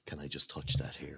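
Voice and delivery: hushed, breathy request; whispered ask to touch the hair. Retold or imitated. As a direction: whisper it like a secret in the pub. Acting out his pub request.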